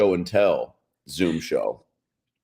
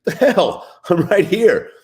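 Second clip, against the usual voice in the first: offended teacher; 12 semitones higher, 3 dB louder, slower than usual. Retold or imitated. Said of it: "She's like".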